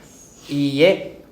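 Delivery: teacher reads the sample answer aloud, slowly; slow model reading. Shown as a demonstration. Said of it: "teacher models example sentence".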